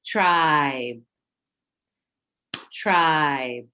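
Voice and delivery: Tribe said as one clear clapped beat; clapped out in syllables. Shown as a demonstration. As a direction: clap out Tribe as a single syllable. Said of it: "So let's clap it out".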